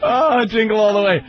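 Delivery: ordinary delivery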